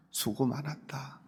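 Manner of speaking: whispered gently, almost breathless; a tender whispered thank-you; soft whisper. Acted. Echoing the meaning softly.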